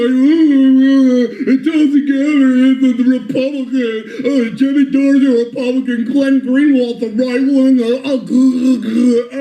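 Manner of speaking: mocking impression. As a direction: parrot them in a silly high voice. Mocking what his opponents say.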